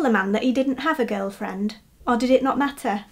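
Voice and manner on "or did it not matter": usual speaking voice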